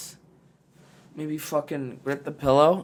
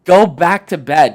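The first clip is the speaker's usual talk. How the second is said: louder, slow, firm order; loud, slow command to go back to sleep; emphatic urging; 4 semitones higher, 11 dB louder, slower than usual. Real, not acted. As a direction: drive it home like a coach.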